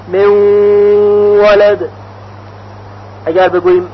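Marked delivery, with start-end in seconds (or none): 0.1-1.9 من ولد with nun merged into a nasal و; merged, ghunna. Shown as a demonstration. Teacher demonstrates the merge to students, nasal.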